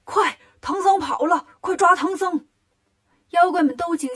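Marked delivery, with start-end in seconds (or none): 0.1-2.5 loud, high alarm from demon bosses; urgent shout. A narrator's reading. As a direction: yell for everyone to give chase. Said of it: "三个老妖赶紧喊". (焦急地高声大喊)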